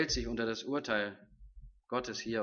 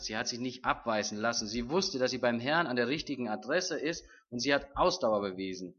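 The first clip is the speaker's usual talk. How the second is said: ordinary talk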